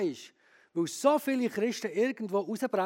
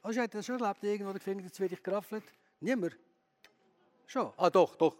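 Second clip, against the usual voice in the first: usual speaking voice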